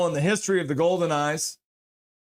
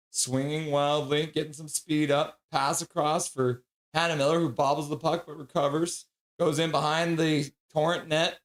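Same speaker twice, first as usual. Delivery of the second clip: play-by-play. Acted. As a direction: call the puck movement like a broadcaster. Live hockey play-by-play.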